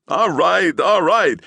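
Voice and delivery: high loud desperate cry. Acted. Gerlich crying out to the policeman. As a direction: cry it out in despair. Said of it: "Gerlich cried".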